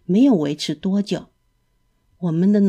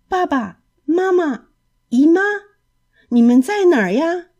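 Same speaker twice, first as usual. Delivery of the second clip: calling out; 9 semitones higher, 4 dB louder than usual. Acted. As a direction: call for your parents across the room. 小鸡在旅馆里呼唤父母. (在旅馆里高声呼唤爸妈)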